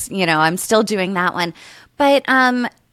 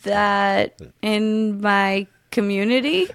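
halting, puzzled sounding out; word by word. Shown as a demonstration. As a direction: feel your way through, ending on a question. Guesser sounds out clue word by word.

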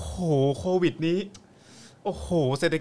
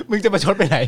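laughing; 5 semitones higher, 7 dB louder than usual. Real, not acted. Laughing at sarcasm.

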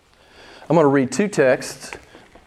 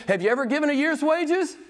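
pressing challenge; 9 semitones higher than usual. Real, not acted. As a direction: push the question home to the congregation. Pastor presses the question.

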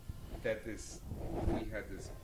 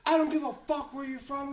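high, louder mock-angry retort; retold anger; 8 dB louder than usual. Drunk customer dismissing bartender's origins, retold anger. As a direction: imitate a drunk snapping back. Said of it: "he starts getting angry".

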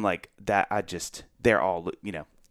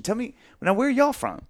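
much higher, bright welcoming question; friendly host; 12 semitones higher than usual. Retold or imitated. Host asking new friends about themselves, friendly and high. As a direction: turn the spotlight warmly onto the guests. Asking guests instead of performing.

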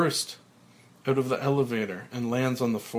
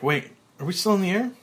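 high, loud, fast worried question; sudden alarm; 7 semitones higher, 6 dB louder, faster than usual. Acted. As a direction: freeze and blurt it out. Frank freezes mid-dance.

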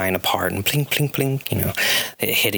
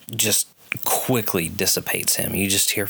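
usual speaking voice

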